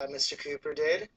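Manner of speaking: ordinary talk, nothing marked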